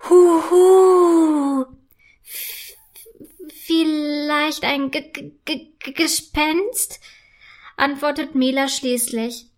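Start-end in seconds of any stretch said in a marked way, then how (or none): 0.1-1.6 loud, high, long-drawn ghost howl; spooky mock-ghost wail; ghostly hooting. A narrator's reading. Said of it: "wiederholt sich das Geräusch". 2.2-7.0 slow, stuttering on the first sounds; hesitant, scared stutter; frightened stammer. A narrator's reading. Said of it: "so starke Angst".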